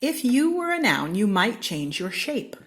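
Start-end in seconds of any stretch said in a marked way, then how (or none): 0.0-1.2 high, loud announcing refrain; bright proclaiming refrain; excited refrain. A narrator's reading. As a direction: call it out like a title.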